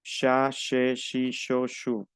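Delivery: cha, che, shi, sho, shu each starting "sh"; measured syllable drill, dropping away on shu; French CH drill. Shown as a demonstration. Teacher repeats for students to practise, even "sh" syllables. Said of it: "Pueden practicar".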